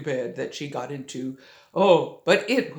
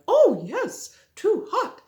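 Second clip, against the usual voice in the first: Mama Bear voice; 12 semitones higher, 6 dB louder, slower than usual. Acted. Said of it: "Mama Bear tasted her porridge and went".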